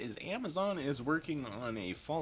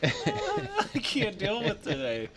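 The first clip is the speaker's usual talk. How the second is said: slow words through laughter; can barely speak for laughing; 11 semitones higher, much slower than usual. Real, not acted. Laughing fit.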